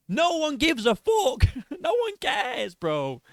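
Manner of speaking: high, drawn-out incredulous protest; exasperated. Real, not acted. Reactor dismissing the race claim, exasperated.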